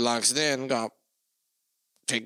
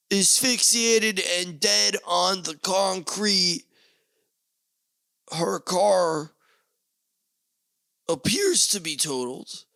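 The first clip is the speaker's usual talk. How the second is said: slow, loud, high breaking-news delivery; hammed-up anchor reading a tragedy; mock news report; 5 semitones higher, 4 dB louder, slower than usual. Comedian spoofs a grim news bulletin, slow and loud.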